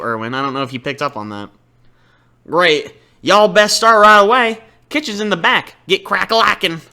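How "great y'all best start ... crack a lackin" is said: gruff Southern shopkeeper, a little louder; Southern accent. Acted. Southern chef grudgingly sets the debt terms, Southern accent. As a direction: grumble it out like a Southern diner owner. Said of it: "a Southern accent".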